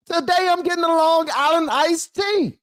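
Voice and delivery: very high, giddy put-on voice; hammed-up wild voter. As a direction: squeal it like a voter letting loose. Same voter, repeated far more wildly.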